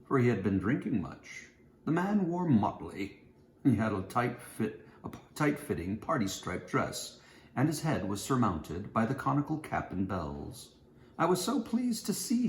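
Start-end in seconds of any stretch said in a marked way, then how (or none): none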